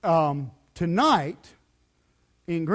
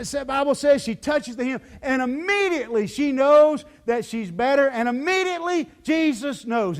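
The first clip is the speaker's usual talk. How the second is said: usual speaking voice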